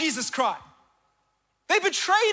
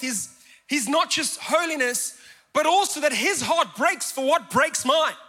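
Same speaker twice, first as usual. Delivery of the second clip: normal talking voice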